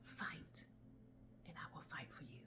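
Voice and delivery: soft whispered promise; calm whisper. A narrator's reading. Calm voice whispering to the dying woman. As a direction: barely breathe the promise. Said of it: "a calm voice says".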